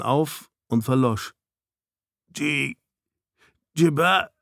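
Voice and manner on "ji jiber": broken-off stammer, pitched higher; stuttering. A narrator's reading. Snow failing to say Jiberian's name, stuttering. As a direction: choke on the name and break off. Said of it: "stotterte er".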